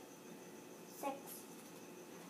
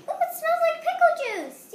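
high, loud, fast delight; excited squeal; 12 semitones higher, 10 dB louder, faster than usual. Real, not acted. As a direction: squeal it out happily. Delighted smelling pickle juice.

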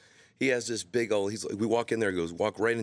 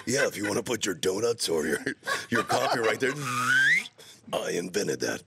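trailer guy voice. Retold or imitated. Voicing LaFontaine about shelf.

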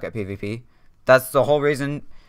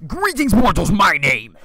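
much higher, theatrical overlord greeting; grand villain intro; 6 semitones higher than usual. Pretend. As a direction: boom it like a god addressing mortals. Grand channel intro persona.

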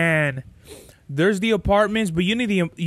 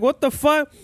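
startled disbelief; 7 semitones higher than usual. Retold or imitated. Retelling his reaction to the punch.

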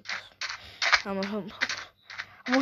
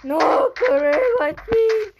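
high panicked shouting; frantic, high-pitched run call; 9 semitones higher, 3 dB louder, much slower than usual. Real, not acted. Chased in game.

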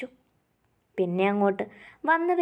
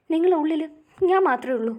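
desperate insistence; 7 semitones higher, 5 dB louder, faster than usual. Acted.

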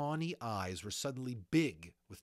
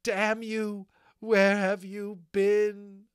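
furious high shout, slow and drawn out; angry cry; 11 semitones higher, 13 dB louder, much slower than usual. Acted. Molly curses the unicorn who came late, furious cry. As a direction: hurl the curse, then stretch out the demand. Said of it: "she cried".

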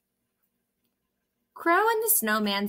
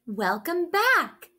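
higher, louder, slow "Welcome back"; excited; 7 semitones higher, 4 dB louder, slower than usual. A narrator's reading. Crow greeting Snow's return, excited. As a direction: stretch the greeting out with delight. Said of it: "said Crow excitedly".

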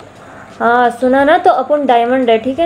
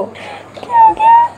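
very high squeaky voice. Acted. Friend cursed, asking what happened in a very high squeak. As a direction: squeak it out like a cartoon.

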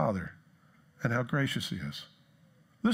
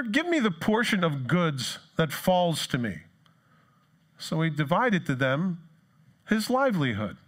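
scripture reading; 4 semitones higher than usual. Acted. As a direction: read the verses deliberately aloud.

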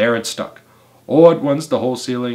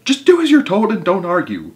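bossy high-pitched shout; 9 semitones higher, 6 dB louder than usual. A narrator's reading. Mugglewump tells helpers to obey, shouting. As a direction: order them loudly, brooking no argument. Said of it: "shouted Mugglewump".

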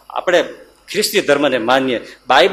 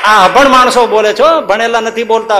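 raised, forceful cry to the crowd; loud exclamation; 7 semitones higher, 6 dB louder than usual. Real, not acted. Preacher praising the unlettered farmer to the crowd, loud high exclamation. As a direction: call it out high and loud to the listeners.

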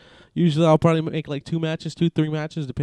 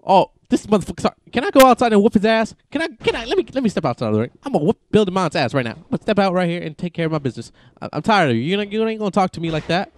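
fast, higher-pitched indignant ranting; mock outrage; 4 semitones higher, 3 dB louder, faster than usual. Player threatening the heckling trainer, mock fury. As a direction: rant as if ready to brawl.

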